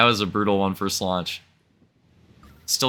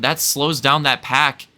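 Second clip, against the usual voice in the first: normal talking voice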